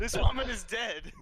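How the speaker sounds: high, loud alarmed outburst; shocked cry. Acted. Player reacting to the sudden death, loud shocked cry. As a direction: blurt out the shock at full volume.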